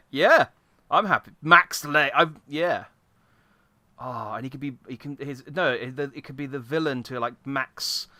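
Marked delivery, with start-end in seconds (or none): none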